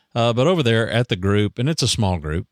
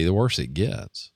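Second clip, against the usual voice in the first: ordinary delivery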